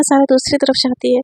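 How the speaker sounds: plain reading voice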